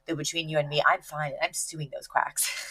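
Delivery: hushed, acted-out admission; confidential aside. Cece leaning in to confide in Nigel, hushed. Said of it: "leans in and she goes".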